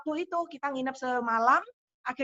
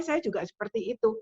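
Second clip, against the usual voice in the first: normal talking voice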